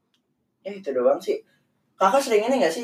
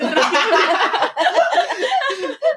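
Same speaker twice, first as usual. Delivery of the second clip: high-pitched peal of laughter; burst of laughter; 13 semitones higher, 4 dB louder than usual. Real, not acted. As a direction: crack up loudly and long. Laughs at the doll's upward stare. (tertawa lepas bernada tinggi)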